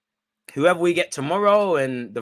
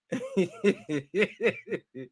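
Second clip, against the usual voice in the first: high, soft wheezing giggle; long helpless giggle, nearly crying; laughing to tears; 4 semitones higher, 6 dB quieter than usual. Real, not acted. Streamer recovering from a funny comment, laughing to tears. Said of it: "I'm literally crying".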